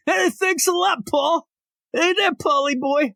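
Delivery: high-pitched, overexcited greeting. Acted. Host imagines meeting [name], starstruck and awkward. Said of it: "I'd be [name]".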